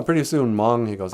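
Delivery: usual speaking voice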